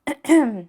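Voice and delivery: loud demonstrative throat clear. Shown as a demonstration. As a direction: clear your throat sharply. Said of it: "clear your throat like this".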